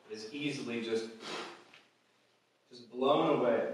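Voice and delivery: ordinary talk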